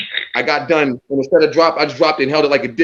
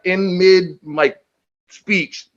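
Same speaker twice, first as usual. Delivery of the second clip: usual speaking voice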